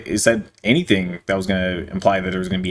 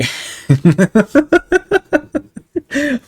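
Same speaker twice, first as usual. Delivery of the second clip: high, loud helpless cackle; real laughing fit; 7 dB louder than usual. Real, not acted. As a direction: crack up uncontrollably mid-sentence. Cracks up reading crude text.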